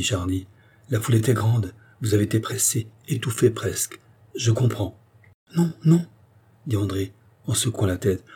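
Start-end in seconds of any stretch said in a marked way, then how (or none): 5.6-6.1 high-pitched, anxious refusal; agitated denial. A narrator's reading. Andrée contradicting the count, agitated denial. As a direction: cut him off with a higher, urgent voice.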